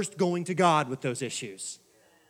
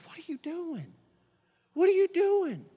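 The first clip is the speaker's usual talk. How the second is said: incredulous; 11 semitones higher than usual. Retold or imitated. Pastor confronting judgmental worshiper.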